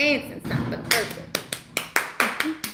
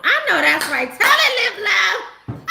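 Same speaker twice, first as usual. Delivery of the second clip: normal talking voice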